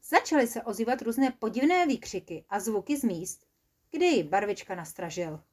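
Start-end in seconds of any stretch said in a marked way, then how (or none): none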